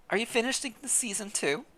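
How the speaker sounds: ordinary talk, nothing marked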